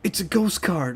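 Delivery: high, thrilled announcement; excited. Real, not acted. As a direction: burst out with the good news. Rare card pull reaction.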